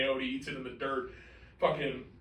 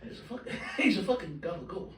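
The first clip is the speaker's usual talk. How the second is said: Tony Soprano impression; 7 dB quieter than usual. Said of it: "my Tony Soprano".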